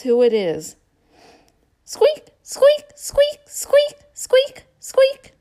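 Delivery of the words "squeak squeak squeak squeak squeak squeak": very high, loud squeaky mouse; mouse squeak. Acted. Mouse sound in guessing book.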